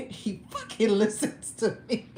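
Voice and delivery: talking through real laughter; laughing. Real, not acted. Laughing at her own impression.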